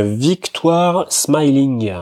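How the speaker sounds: "Victoire Smiling" said slowly, with "Smiling" in a showy English accent as /smailiŋ/. Shown as a demonstration. Racing tipster showing off his English accent on the horse's name, slow and proud. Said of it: "T'as vu, l'accent anglais, il est top".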